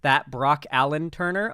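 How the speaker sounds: ordinary talk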